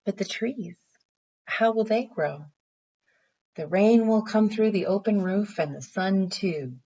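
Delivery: gentle storybook read-aloud; reading to children. Acted. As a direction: read it like a picture book for little kids. Teacher reads picture book aloud.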